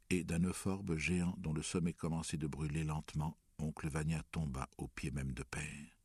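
ordinary delivery, nothing marked

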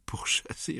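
scornful high breathy scoff; sulky sneer. A narrator's reading. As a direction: sneer the word back at him. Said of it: "ricana Caroline d'un ton maussade".

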